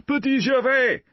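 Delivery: high, loud calling out into the dark; searching for the lost boy in the dark; shouting a name. A narrator's reading.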